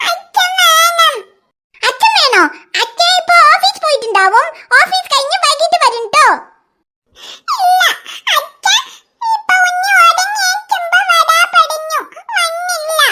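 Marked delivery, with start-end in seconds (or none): 0.1-1.2 drawn-out whiny cry, higher; crying child. Acted. Little girl crying to older sister for her father. 7.6-13.0 drawn-out whiny cry, higher; crying child. Acted. Little girl crying to older sister for her father.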